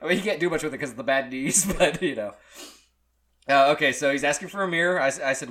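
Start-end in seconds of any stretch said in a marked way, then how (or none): none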